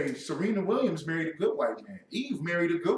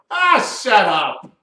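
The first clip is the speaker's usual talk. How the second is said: loud, slow, higher-pitched rebuke; exasperated dismissal; 4 semitones higher, 9 dB louder, slower than usual. Real, not acted. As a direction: shut it down loudly, drawn out. Scornful answer to quoted excuse.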